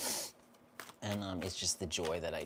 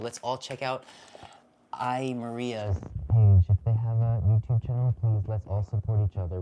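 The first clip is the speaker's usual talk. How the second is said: rallying call; 8 dB louder than usual. Real, not acted. Rallying viewers to support channel.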